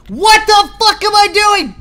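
real high, loud shriek of disbelief; startled gamer's full-volume outburst; panicked yell. Real, not acted. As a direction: yell it as everything suddenly goes wrong. Sudden mistake while playing game.

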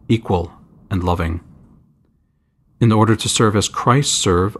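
usual speaking voice

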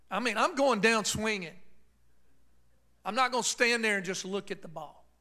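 loud, rising sermon cadence; preaching. Real, not acted. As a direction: preach it out at full force. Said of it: "I feel a preacher coming on here".

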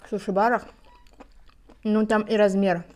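talking while eating salad; chewing. Real, not acted. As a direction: mouth full of seaweed. Seen 1: Puts seaweed salad in mouth at 3.7, then chews; no sushi.

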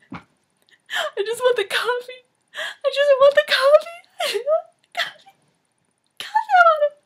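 very high squealing sobs; demonstrated crying. Shown as a demonstration. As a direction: cry through the words in a hammed-up audition wail. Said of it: "it's time to cry".

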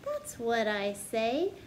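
bouncy storybook narration building up the list; story reading. Acted. Teacher reading a silly picture book to kids, bouncy storytelling. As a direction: read it like a picture book to children. Sings-songy refrain, teasing guesses.

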